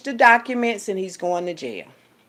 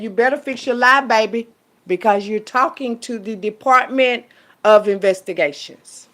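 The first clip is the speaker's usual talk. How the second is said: reading it out again; 3 semitones higher, 7 dB louder than usual. Shown as a demonstration. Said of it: "What it say?".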